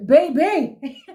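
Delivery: swooning laugh at the TV. Real, not acted. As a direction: melt into a giggle. Swooning over Andile's line.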